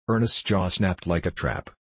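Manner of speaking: ordinary delivery, nothing marked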